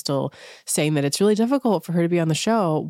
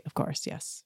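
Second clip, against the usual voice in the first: whispered acceptance; 2 semitones lower, 12 dB quieter than usual. Retold or imitated. Sutton accepts apology softly.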